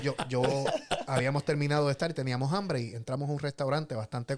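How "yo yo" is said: normal talking voice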